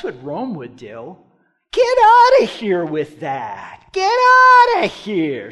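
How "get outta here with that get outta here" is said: loud, high, drawn-out mock shout; dismissive yell. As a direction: yell them out of your court, stretching the first words. Acts out Rome throwing them out.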